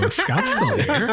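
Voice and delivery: mock robot. Imitating the robot line, laughing.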